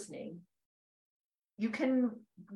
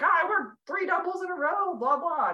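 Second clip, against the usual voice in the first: higher, louder mock brag; "Oh, I work three doubles in a row" said higher and louder, like a bragging coworker; mocking show-off coworkers; 9 semitones higher, 5 dB louder than usual. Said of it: "all these people are like".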